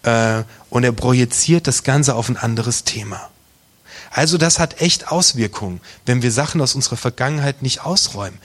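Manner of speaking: normal talking voice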